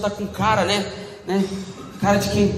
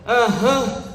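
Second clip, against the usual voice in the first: sulky closed-mouth grunt of assent. Acted. Sulking partner brushing off "tudo bem, amor?", sulky. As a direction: brush off the question in a mock sulk. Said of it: "que você tá emburrada".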